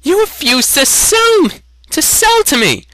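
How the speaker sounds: very high, loud disbelieving cry; boy furious at being refused; outraged. Acted. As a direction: stammer it out in outrage.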